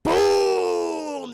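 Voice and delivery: deep booming explosion; loud low boom; explosion sound. Retold or imitated. Retelling explosion sound effect.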